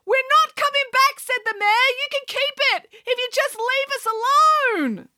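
high, strained mayor insisting; a fleeing mayor refusing to return; high-pitched mayor. A narrator's reading. Mayor voiced.

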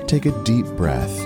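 ordinary talk

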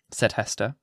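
plain reading voice

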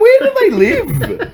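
very high, slow laughing disbelief; loud, high-pitched bafflement; incredulous. Acted. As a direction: squeal the question, stretched out and amused. Mock disbelief at absurd plot.

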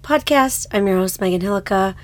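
ordinary talk

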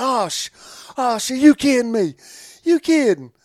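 high, loud, excited disbelief; hammed-up fan. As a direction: act like you can't believe your luck. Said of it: "He was like".

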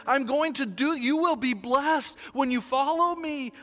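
sermon climax over music; preaching. Acted. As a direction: drive the message home like a pastor.

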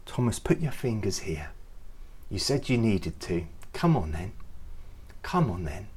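soft, low, tender invitation; gentle kindness toward Thomas; kind. Pretend. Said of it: "You can just hear the kindness".

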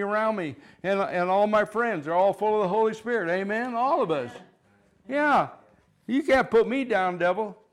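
loud, fast rallying cry against the devil; triumphant pulpit shout; full-force preaching. Acted. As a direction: preach it out, defying Satan. Declaring victory over Satan.

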